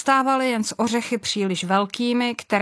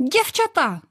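put-on high, delighted call to the girls; excited call; 10 semitones higher than usual. A narrator's reading. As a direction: sing out the girls' name with joy.

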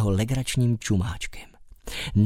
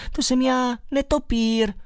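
calling out; 12 semitones higher than usual. Acted. Bat keeps calling to mole.

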